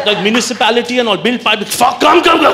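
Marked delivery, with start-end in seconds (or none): 1.7-2.5 higher, louder, beckoning; mock over-keen invitation; eager welcome. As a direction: wave them in eagerly.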